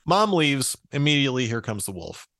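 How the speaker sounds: ordinary delivery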